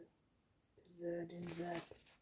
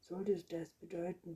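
ordinary delivery, nothing marked

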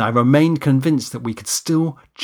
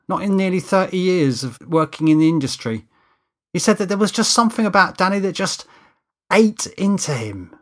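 higher-pitched crew mate passing on news; lightly voiced Steve, confiding; 6 semitones higher, 3 dB louder than usual. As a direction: report a colleague's woes in a lightly put-on character voice.

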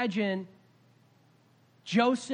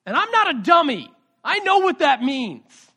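loud, higher protest that he is no fool; indignant outburst; 7 semitones higher, 9 dB louder than usual. Acted. Father to son, insisting he's no fool, loud and indignant. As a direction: push it out hard, stung and defensive. Joseph recalls pregnancy news, indignant.

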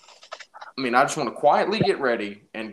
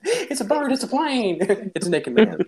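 high, laughing announcer voice; mock announcer; 8 semitones higher than usual. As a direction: call it out like a superhero movie.

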